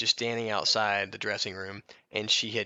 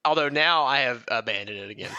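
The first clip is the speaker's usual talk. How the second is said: giggling as he owns up to the switch; laughing admission; 2 semitones higher than usual. Real, not acted. Admitting to his cohost he changed again, laughing. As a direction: say it through a chuckle.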